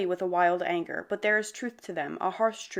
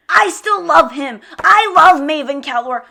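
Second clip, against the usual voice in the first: unhinged fan yell; 8 semitones higher, 14 dB louder than usual. Real, not acted. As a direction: shout your love like you've lost it. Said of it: "have me committed to an institution".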